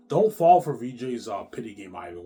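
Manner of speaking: loud warning. Real, not acted. Warning Ivan loudly.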